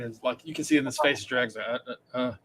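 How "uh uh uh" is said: low, soft grumble; reluctant dragon. Acted. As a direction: grumble quietly as an unwilling dragon.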